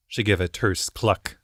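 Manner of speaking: usual speaking voice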